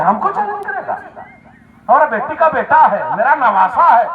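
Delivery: high, loud affronted retort; acted disbelief at being challenged; indignant challenge. Acted grandfather answering a challenge.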